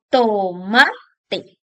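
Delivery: tomate stretched slowly, weight on MA; stress on middle syllable. Shown as a demonstration. Teacher showing the middle-syllable accent, stress on MA in tomate. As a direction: break up tomate and lean on "ma". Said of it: "No lo tiene en la primera ni en la última".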